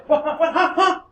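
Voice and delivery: high, laughing startled what-what. Retold or imitated. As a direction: sputter in mock confusion. Guy realizes work lost.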